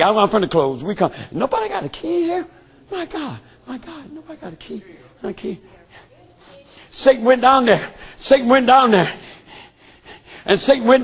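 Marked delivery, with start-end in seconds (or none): 1.3-5.6 high, hushed calls into the dark; softer, higher, searching and lost; hushed calling out. Acted. Preacher voicing souls in hell, hushed and high.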